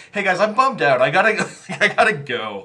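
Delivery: high, loud retold plea to leave; half-laughing, high-pitched mock upset; acting bummed out. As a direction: replay bailing out upset, breaking into a laugh. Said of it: "I made myself sad".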